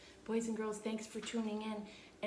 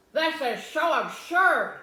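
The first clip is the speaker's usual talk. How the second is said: loud, slow, scornful outburst; indignant; 8 semitones higher, 14 dB louder, slower than usual. A narrator's reading. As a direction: spit out the disgust. Eugenia disapproves loudly.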